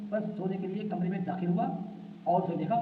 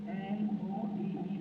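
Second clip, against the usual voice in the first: letters spelt out slowly one by one; teacher spelling a word for pupils; 5 dB quieter, much slower than usual. Shown as a demonstration. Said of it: "spelling out the word".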